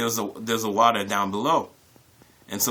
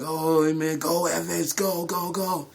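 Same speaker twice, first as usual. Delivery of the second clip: mock crowd chant, pitched higher; friends cheering him on; 7 semitones higher, slower than usual. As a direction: egg him on like the guys at the cliff.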